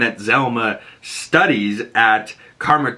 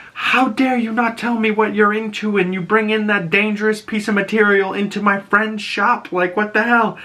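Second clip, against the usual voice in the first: higher, scolding mom tirade, mock-angry; mom losing it; 11 semitones higher than usual. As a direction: play the furious mother telling her off. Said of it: "her mom loses it at Riri".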